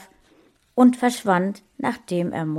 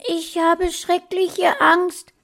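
put-on high, slow, trembling little boy's voice; boy confessing his fear to Mona on the phone; frightened child; 12 semitones higher, 5 dB louder, slower than usual. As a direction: speak it small and scared.

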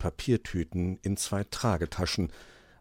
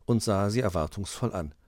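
plain reading voice

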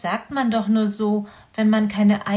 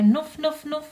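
high little nuff sniffs, sighed out; sighing hedgehog sniffs; 7 semitones higher, 4 dB louder than usual. Acted. Mother hedgehog sighing with little sniffs at her son. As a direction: sniff three times with a sigh. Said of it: "seufzte Frau Igel".

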